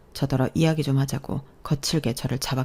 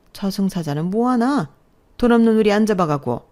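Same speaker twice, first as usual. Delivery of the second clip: indignant mother-in-law; 5 semitones higher, 3 dB louder than usual. Acted. Mother-in-law crying out to the neighbours about her daughter-in-law, indignant lament. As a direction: appeal to the whole neighbourhood in outrage.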